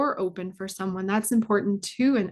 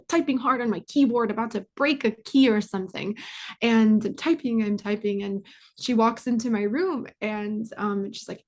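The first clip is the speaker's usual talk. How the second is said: usual speaking voice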